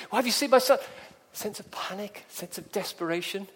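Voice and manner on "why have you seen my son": higher, louder frantic question; panicked plea. Acted. Parent running to a policeman, panicked plea. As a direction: blurt it out in a panic.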